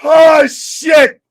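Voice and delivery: high, loud outburst with a drawn first word; stunned exclamation. Real, not acted. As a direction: blurt it out in amused disbelief. Reacting to outrageous chat jokes.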